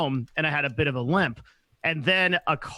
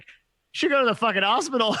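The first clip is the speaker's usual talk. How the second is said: higher mock blunt dad; 8 semitones higher than usual. Dad on the phone telling son to go, mock voice. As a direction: toss it off like an exasperated father. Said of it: "he's like".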